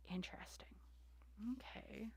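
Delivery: barely audible murmur, then a whisper; hushed aside. Real, not acted. Reader reacting to a new card under her breath, whispered. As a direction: breathe it to yourself, almost silent.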